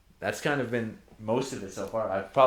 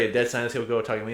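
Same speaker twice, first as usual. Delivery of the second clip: ordinary talk, nothing marked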